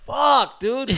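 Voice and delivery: high, loud reenacted curse; mad again. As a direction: burst out in mock fury. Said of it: "I was getting mad again".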